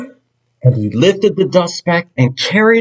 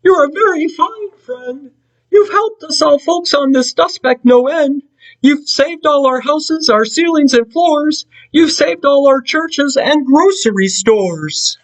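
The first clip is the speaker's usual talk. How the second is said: very high, small and far-off; tiny Who voice; 12 semitones higher than usual. Acted. Mayor of Whoville calling up to Horton, tiny high voice. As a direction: speak up from somewhere tiny.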